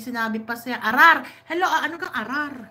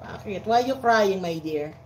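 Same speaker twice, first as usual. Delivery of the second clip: ordinary delivery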